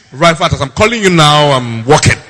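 loud, grand proclamation of being busy; mock self-importance. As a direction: declaim it like urgent national duty. Acts the dignified busy reply.

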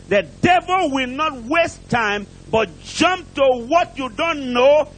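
ordinary talk, nothing marked

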